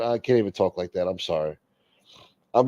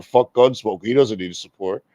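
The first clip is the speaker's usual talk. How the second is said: a lightly put-on voice of a stingy viewer, dismissive; lightly mocking, shrugging viewer. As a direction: toss it off like a careless viewer who quits paying. Mocking what viewers think after show.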